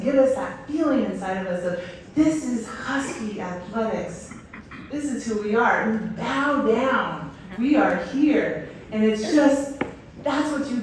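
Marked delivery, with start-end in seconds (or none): 2.2-8.7 slow, emphatic rallying words; rousing declaration. Acted. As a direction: stretch each phrase out with pride. Voicing the rousing feeling the songs give.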